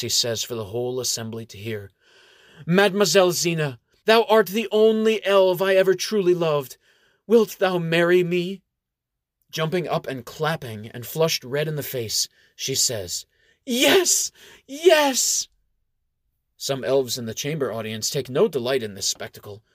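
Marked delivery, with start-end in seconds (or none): 2.7-8.6 louder, higher, declaring to the hall; loud manly voice. Acted. Nganyon proposing to Zena loudly before the assembly. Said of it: "In a loud, manly voice". 13.7-15.5 squealing much higher and louder; ecstatic yes. Acted. Zena accepting the proposal with a joyful squeal.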